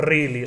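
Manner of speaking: "really" with a trilled R at the start; rolled R. Shown as a demonstration. Showing how the friend's accent changed, rolled R on "really". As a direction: roll the R hard on "really". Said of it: "she rolled it".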